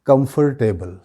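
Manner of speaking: "कंफर्टेबल" with a full far, kam-far-tebal; spelled-out "कंफर्टेबल", com-fer-table; common mispronunciation. Shown as a demonstration. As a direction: say "कंफर्टेबल" sounding the r. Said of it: "क्या कहते हैं हम लोग".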